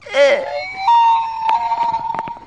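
very high drawn-out wail; hammed-up high-pitched bawling; loud wailing cry. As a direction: let out a long mock sob. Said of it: "you can stop crying now".

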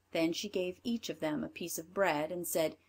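ordinary delivery, nothing marked

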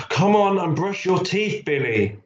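high mum's call, dropping on the name. A narrator's reading. Mum hurrying Billy to brush his teeth, calling. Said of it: "Mummy called".

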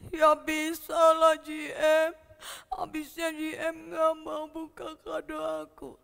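put-on sobbing child's protest; tearful whining. Boy answers that grandma never opened his gift, tearful. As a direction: whine it out through mock tears. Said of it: "kamu bisa nangis ya".

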